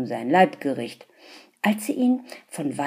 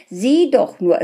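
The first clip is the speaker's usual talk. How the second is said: high, loud eager urging; excited; 6 semitones higher, 11 dB louder than usual. A narrator's reading. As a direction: urge her to look, thrilled. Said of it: "schrie er begeistert".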